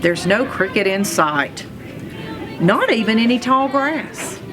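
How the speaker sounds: ordinary delivery, nothing marked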